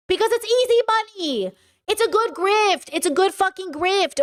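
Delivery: rant, pitch up and pushing; heated rant. Real, not acted. Ranting about grifters.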